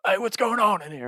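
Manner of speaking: high-pitched hollered Southern dad voice; Southern accent. Retold or imitated. Girlfriend's dad bursting in on the couple, mock Southern voice. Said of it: "this real deep Southern voice".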